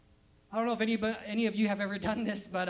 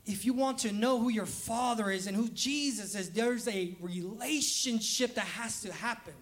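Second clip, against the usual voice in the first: ordinary talk, nothing marked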